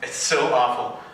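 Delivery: ordinary talk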